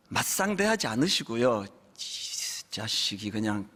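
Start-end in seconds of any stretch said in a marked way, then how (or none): 2.0-3.7 low, slow, quiet menacing God voice; imagined angry God. Retold or imitated. Imagined God grumbling about the rebellious youth, low and menacing. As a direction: growl it low and slow like a fed-up father. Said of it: "하나님이 한번 이렇게 확 꺼버렸으면".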